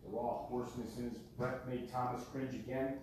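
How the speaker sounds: usual speaking voice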